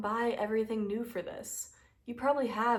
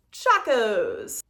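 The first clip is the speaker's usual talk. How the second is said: loud comic exclamation; mock-triumphant shout; 2 semitones higher, 8 dB louder than usual. Answering viewers who might judge her sandals, mock-triumphant shout. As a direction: call it out loud, like a silly battle cry.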